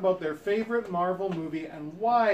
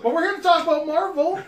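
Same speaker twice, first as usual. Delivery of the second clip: loud; 10 semitones higher, 7 dB louder than usual. Real, not acted. Host reining in off-topic chat.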